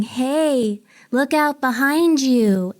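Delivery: put-on lilting little girl, words stretched out slowly; little girl's voice. Passenger retells voice heard during drink service, little girl voice. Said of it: "A little girl's voice going".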